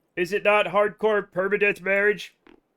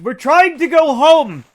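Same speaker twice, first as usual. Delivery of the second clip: loud, high and slow exasperated shout; drawn-out exasperated cry; frustrated yell; 6 semitones higher, 10 dB louder, slower than usual. Real, not acted. Frustrated at game character.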